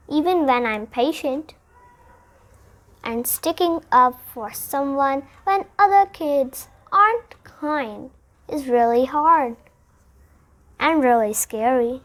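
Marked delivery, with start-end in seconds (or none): none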